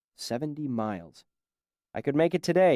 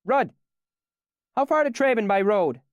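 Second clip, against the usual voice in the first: higher and louder, calling to a man along the bar; 10 semitones higher, 5 dB louder than usual. Acted. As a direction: call out across the room. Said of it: "then called down the bar".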